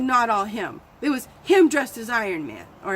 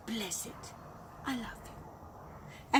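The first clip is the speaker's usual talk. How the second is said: soft whispered bless it and I love you; hushed blessing; 13 dB quieter, much slower than usual. Shown as a demonstration. Blessing the insult away, whispering. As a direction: drop to a tender whisper. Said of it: "demo of blessing".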